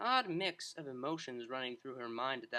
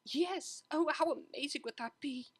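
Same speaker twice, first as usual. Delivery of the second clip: put-on higher, thrilled young mare; Octavia's voice; 12 semitones higher than usual. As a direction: sing out the delight as the cellist.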